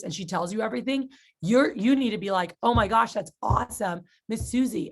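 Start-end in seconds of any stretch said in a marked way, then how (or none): none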